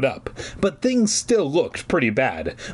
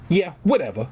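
dismissive; 4 semitones higher than usual. Acted. Dismissive reply.

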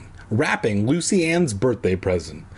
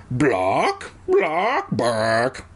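chicken clucks; much slower than usual. Acted. Clucking heard from outside.

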